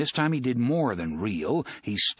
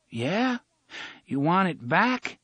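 slow, aching speech; 8 semitones higher, slower than usual. Acted. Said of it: "O'Meara had to speak slowly".

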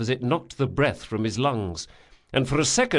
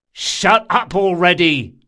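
loud, curt command; irritated bark; 6 semitones higher, 8 dB louder than usual. A narrator's reading. Locke silencing the whining bandit, irritated. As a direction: cut him off.

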